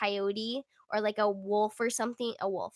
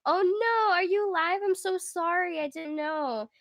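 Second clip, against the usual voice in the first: high, gushing apology; dismayed apology; 8 semitones higher, slower than usual. Real, not acted. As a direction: voice shoots up in sudden dismay. Apologizing to a viewer who streams.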